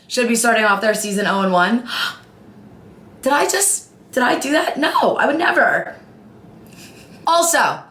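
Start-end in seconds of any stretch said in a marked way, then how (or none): 1.9-4.8 gasp, then feigned innocence; fake-shocked did I do that; mock surprise. Mock shock after Cowboys jab. 4.8-6.0 laughing fake denial.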